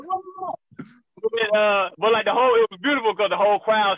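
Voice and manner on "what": very high, breathy what; mock shocked what. Comedian retelling his surprise, squeaky high what. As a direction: squeal it in disbelief. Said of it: "I'm like".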